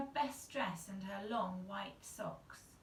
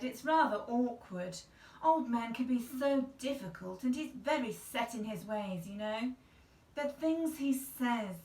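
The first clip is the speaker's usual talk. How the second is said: louder, higher prim fretting; fussy aunt; 4 semitones higher, 6 dB louder than usual. Acted. Prim aunt confiding to the mother, fussy. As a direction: fuss like a tidy little lady.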